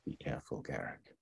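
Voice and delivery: hushed, caring farewell; tender farewell. Acted. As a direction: say it gently, almost under your breath.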